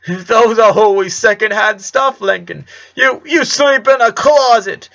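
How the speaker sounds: a father pouring out his money worries; distressed father. Acted. Father laments.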